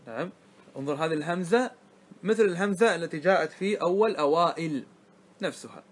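normal talking voice

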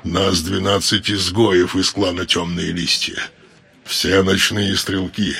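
low, slow and cold elf voice; low, measured menacing drawl; dark elf voice. A narrator's reading.

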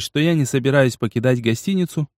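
ordinary delivery

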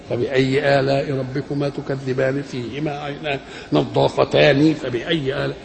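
low, level Quran recitation joined verse to verse; connected recitation. Shown as a demonstration. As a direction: recite evenly, carrying each ending into the next verse. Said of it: "انما هو مبني". (ترتيل هادئ موصول الآيات)

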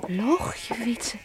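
shocked whisper. Acted. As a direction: whisper it in dismay. Shocked by his confession. (geschokt gefluisterd)

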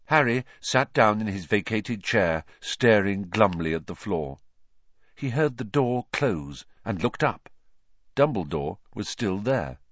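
ordinary delivery

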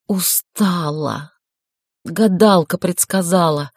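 weak, slow with long pauses; fading words, long breaths between; slow, halting whisper. A narrator's reading. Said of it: "медленно, с длинными паузами прошептала".

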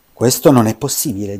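loud, astonished "Questo non è possibile"; the king cries out in disbelief; astonished exclamation. Acted. Il re stupito si alza. (stupore ad alta voce, il re esclama)

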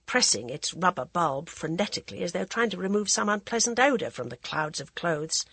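ordinary delivery, nothing marked

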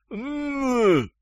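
very high muffled whine; gagged grunt. A narrator's reading. Gagged captive promises not to scream, high muffled grunt. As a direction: hum a pleading no through a gag. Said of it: "замотал головой Шустрый".

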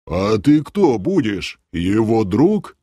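slow, probing questions; suspicious guard. A narrator's reading. Guard questioning the creature's acquaintance, suspicious. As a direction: draw out each question with distrust. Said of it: "подозрительно прищурился страж".

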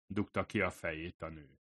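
ordinary delivery

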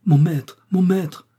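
loud, high, urgent call; crying out. A narrator's reading. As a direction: call out in alarm for the master. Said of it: "s'écria la". (cri d'appel pressant, fort et aigu)

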